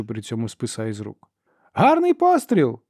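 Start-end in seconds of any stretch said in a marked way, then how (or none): 1.7-2.8 high, slow, drawn-out yell; woman shouting. A narrator's reading. Sister hails Ferro's bowshot, shouting. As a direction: holler praise across a field. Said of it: "крикнула жінка".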